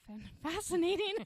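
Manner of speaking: high laughing squeal mid-word; giggling through the word. Real, not acted. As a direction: crack up while saying it. Laughing at vibrator talk.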